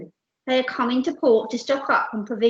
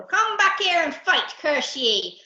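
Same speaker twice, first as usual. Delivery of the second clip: loud, raised pirate howling; howled challenge; 5 semitones higher, 6 dB louder, slower than usual. Acted. Captain to the fleeing ship, howling challenge. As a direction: howl curses across the sea. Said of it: "howled Captain Abdul".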